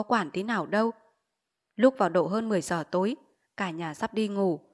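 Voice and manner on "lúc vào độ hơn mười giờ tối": ordinary delivery, nothing marked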